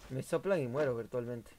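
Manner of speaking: ordinary delivery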